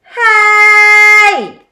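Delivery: high, loud call for attention; loud shout. A narrator's reading. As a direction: yell to stop everyone. Forky shouts to stop chaos.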